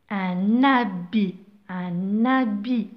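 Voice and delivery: "un habit" said slowly, N linked into habit; slow liaison demo. Shown as a demonstration. Teacher showing students the liaison on habit. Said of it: "J'ai fait la liaison".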